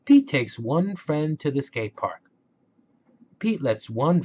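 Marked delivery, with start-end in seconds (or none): none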